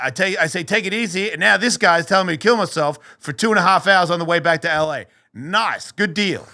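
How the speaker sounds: high, indignant mock thanks; sarcastic complaint. Sarcastic retold complaint to God.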